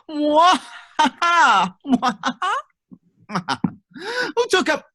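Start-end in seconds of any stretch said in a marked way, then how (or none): 0.1-2.5 mad scientist. Pretend. Villain laugh in ransom note.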